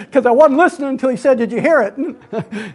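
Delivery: laughing through the words; real laughter breaking into the telling; amused recollection. Real, not acted. Laughing at own childhood memory.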